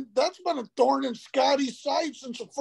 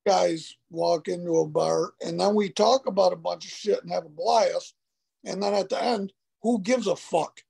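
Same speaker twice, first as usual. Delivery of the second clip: bass voice; 6 semitones lower than usual. Real, not acted. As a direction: drop into a deep bass register. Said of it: "get that bass out of your voice".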